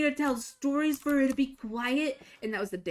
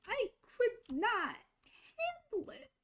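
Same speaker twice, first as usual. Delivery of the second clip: strained confession; 13 semitones higher, 4 dB quieter than usual. Real, not acted. Confessing to viewers she couldn't cope, voice high and breaking. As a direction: voice cracking as she admits it.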